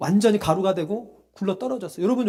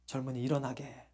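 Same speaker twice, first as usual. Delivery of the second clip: 나지막한 말; 8 semitones lower, 13 dB quieter than usual. Retold or imitated. Acts out man beside him.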